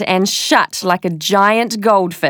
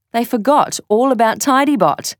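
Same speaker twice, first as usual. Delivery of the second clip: plain reading voice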